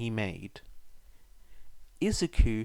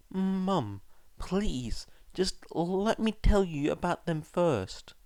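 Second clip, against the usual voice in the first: put-on higher boy's pleading voice; 6 semitones higher, slower than usual. A narrator's reading. Son pleading with his mother to hear him out. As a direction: beg her to listen. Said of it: "Izuku pleaded".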